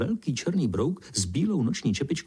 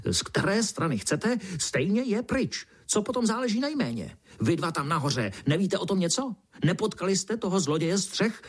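high-pitched fuming beetle; raging beetle; 7 semitones higher than usual. A narrator's reading. Beetle ranting about the roof thief, raging. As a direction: rant on indignantly in a high little voice. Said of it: "černý brouk se vztekal dál".

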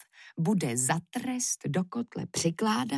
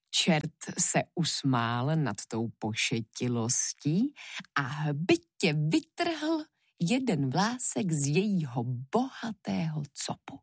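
ordinary delivery, nothing marked

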